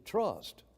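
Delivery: "Trust" set alone as a single weighty word; emphasis on Trust. Shown as a demonstration. Preacher showing each word matters, isolated emphatic "Trust". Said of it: "emphasize every word because there's an emphasis".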